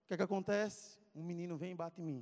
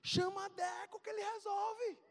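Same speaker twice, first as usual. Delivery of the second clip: put-on high, stretched little-boy voice; excited squeaky schoolboy; child calling friend; 14 semitones higher, slower than usual. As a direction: urge your friend in a high kid voice. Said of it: "Aí eu cheguei lá".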